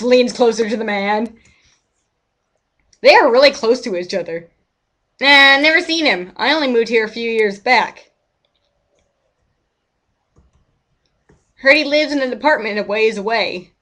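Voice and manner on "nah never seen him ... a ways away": put-on a folksy old neighbour lamenting; old man NPC.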